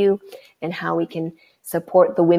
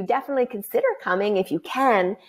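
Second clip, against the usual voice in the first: ordinary delivery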